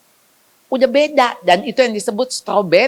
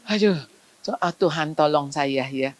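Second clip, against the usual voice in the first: ordinary talk, nothing marked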